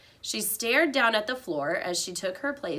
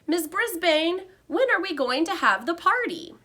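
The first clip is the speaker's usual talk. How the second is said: put-on high, louder eager child's blurt; 10 semitones higher, 4 dB louder than usual. A narrator's reading. Girl blurting an impatient question at her teacher. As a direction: burst out before anyone can stop you. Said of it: "Heidi blurted out".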